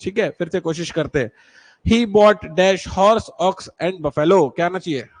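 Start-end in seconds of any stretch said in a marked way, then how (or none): 1.9-4.5 very slow, word by word; slow dictation. Shown as a demonstration. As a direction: read it out slowly for the class. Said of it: "teacher reads exercise slowly". (धीमे-धीमे पढ़कर बोलना)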